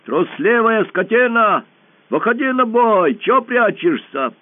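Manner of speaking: high, slow, loud defiant yell; boy yelling at a hidden beast; shouting challenge. A narrator's reading. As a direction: dare the coward to come out. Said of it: "и закричал".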